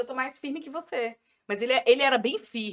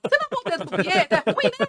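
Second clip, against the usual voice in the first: high, fast and louder, laughing; mother teasing her husband, high and laughing; teasing retort; 10 semitones higher, 5 dB louder, much faster than usual. Retold or imitated. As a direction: toss it back with a laugh. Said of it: "Eu falei".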